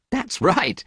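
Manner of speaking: plain reading voice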